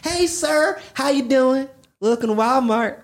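put-on high, drawled Southern country accent as a greeter. Imitating a Southern Walmart greeter welcoming a customer, high-pitched country accent. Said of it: "that accent".

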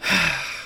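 weary, fed-up sigh; exasperated sigh. Real, not acted. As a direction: let out a long breath in frustration. Fed up with the finishes.

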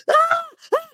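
very high, shrill scream of fright; screaming in fright at a jump scare; startled shriek. Retold or imitated. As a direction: yelp as if someone leapt out at you. Startled by a prank.

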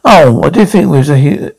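normal talking voice

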